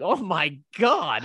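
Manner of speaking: hammed-up dismay, dragged out slowly; mock horror. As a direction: react aghast, stretching the words out. Mock horrified reaction to the scene.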